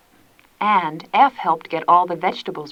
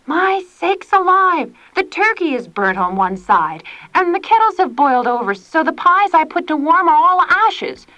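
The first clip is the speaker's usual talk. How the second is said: high, loud dismayed scolding; 10 semitones higher, 5 dB louder than usual. A narrator's reading. Tilly scolding over the burnt dinner. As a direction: fret and scold at once. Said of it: "scolded Tilly".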